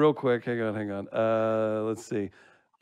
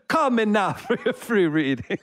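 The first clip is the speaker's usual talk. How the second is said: high-pitched, hammed-up Caribbean psychic; a laughing, failed stab at a Caribbean accent; badly faked Caribbean accent; 9 semitones higher, 3 dB louder than usual. Host trying the hotline line once more, poor Caribbean accent. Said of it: "I can't do it at all".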